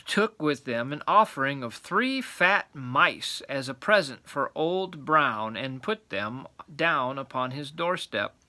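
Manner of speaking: usual speaking voice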